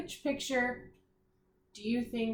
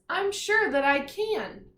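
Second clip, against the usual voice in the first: higher, louder, slow and sure; confident girl; 8 semitones higher, 5 dB louder than usual. Acted. Teacher voicing a confident girl in a picture, higher and sure. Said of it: "She says".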